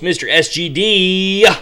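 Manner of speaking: usual speaking voice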